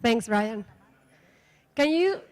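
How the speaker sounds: normal talking voice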